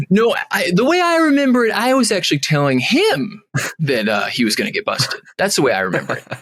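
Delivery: high, self-satisfied mock voice; smug revisionist. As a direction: rewrite history with a smug grin. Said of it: "He would probably be like".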